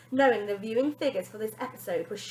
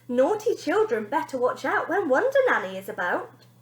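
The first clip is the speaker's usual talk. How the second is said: nanny catchphrase; 5 semitones higher, 7 dB louder than usual. Acted. Nanny says her catchphrase.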